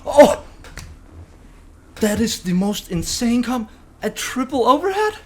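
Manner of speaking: loud, high-pitched, astonished outburst; amazed excitement. Real, not acted. Gamer reacting to an unexpected triple combo, amazed excitement.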